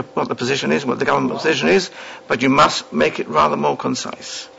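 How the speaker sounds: ordinary talk, nothing marked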